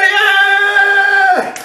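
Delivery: long, high, held "yeah"; imitated crowd cheer. Gym friends celebrating his overall win, long high cheer. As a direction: cheer like a whole fan block.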